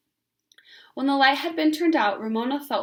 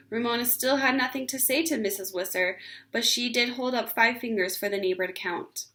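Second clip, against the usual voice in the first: plain reading voice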